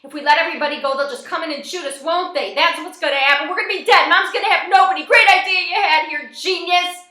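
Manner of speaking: high, loud rant that keeps escalating; frantic, angry shouting; panicked yelling. A narrator's reading. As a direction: yell in rising panic to a sarcastic finish. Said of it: "Stormy yells".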